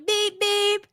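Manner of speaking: high-pitched mock car beep; car horn imitation. Mom pulling up to pick up her son, imitated car horn. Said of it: "I pull up and I'm like".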